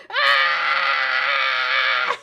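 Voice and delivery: low, loud, raw yell of frustration; anguished shout. Real, not acted. Gamer yells after missing the shot, loud anguished yell. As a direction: bellow in pain at the miss.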